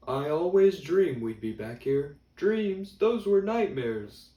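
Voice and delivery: high, loud heckler voices; heckling old guys. Retold or imitated. Quoting the old guys' heckling.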